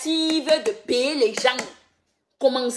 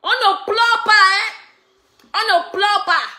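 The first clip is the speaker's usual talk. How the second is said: singsong scolding, pitched up; mocking scold; 6 semitones higher than usual. Real, not acted. Mocking chant repeated. (gronderie moqueuse)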